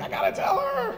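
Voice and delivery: half-laughing, can't hold it in; gleeful. Retold or imitated. His own eager reply, laughing.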